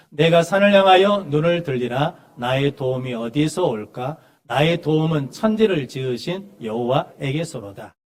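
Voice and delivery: slow, projected psalm recitation; measured reading aloud; scripture reading. Acted. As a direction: read the verse out for a congregation to join, strong at the opening. Leads congregational psalm reading.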